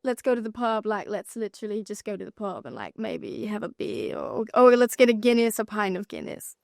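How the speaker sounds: put-on shy, laughing try at a British accent; a hesitant go at a London pub British accent; British accent attempt. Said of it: "can you do a good British accent".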